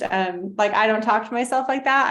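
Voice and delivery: ordinary delivery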